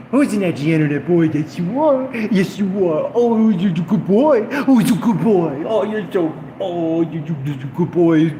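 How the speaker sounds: put-on high, loud baby talk to a dog; talking down to a chat troll like praising a dog; mock pet talk. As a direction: coo at the troll like a puppy.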